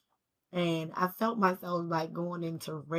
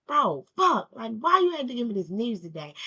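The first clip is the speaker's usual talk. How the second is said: angry outburst; 3 semitones higher, 7 dB louder than usual. Retold or imitated. Angry reply to bad news.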